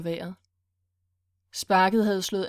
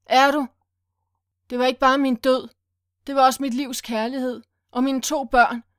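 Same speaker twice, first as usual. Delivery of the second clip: higher, louder anguished reproach, fading at the end; bitter accusation; 3 semitones higher, 4 dB louder than usual. Acted. Willy kneels by Sylvester and accuses him, bitter and anguished. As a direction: throw his whole loss at him. Villain confronts, lost everything.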